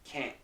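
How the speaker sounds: "can't" nasal, with no T. Shown as a demonstration. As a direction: whisper "can't" with a nasal vowel. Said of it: "this part is nasalized".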